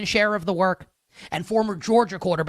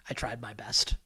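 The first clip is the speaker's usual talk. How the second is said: whispered Southern-accent apology, half-laughing at himself; sheepish whisper; 8 semitones lower, 9 dB quieter than usual. Real, not acted. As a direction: whisper it like a guilty confession after a failed accent. Sheepish after failed impression.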